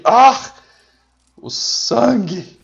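loud high cry, then drawn-out lament; melodramatic agony. Pretend. Gamer playing a soldier losing blood, melodramatic agony. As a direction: cry out slowly as if mortally wounded. Mock dying soldier in the game.

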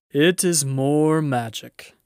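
loud, slow, majestic lion; great voice. A narrator's reading. Great voice answering the girls from behind, loud and slow. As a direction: proclaim it slowly in a great voice. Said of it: "said a great voice".

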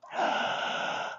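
long audible intake of breath; excited gasp. Real, not acted. Trainer reacting to the dog, gasp. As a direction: gasp for the dog's benefit.

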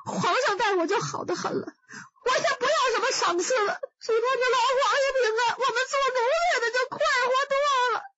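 high, fast sobbing gush; fake wailing. Wei Xiaobao refuses reward from the emperor, high fast wailing. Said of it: "他这一通哭啊".

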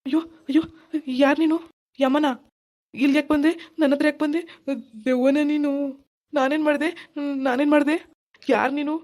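a scared man pleading with a ghost; frightened. Acted. Husband terrified by ghost. (ಭಯದಿಂದ ಗಾಬರಿಯಾಗಿ ಕೇಳುವುದು)

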